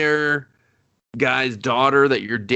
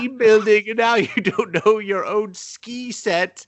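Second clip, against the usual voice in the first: hammed-up outraged girlfriend, pitched way up; mock scolding; 10 semitones higher than usual. Girlfriend telling off her boyfriend, mock scolding.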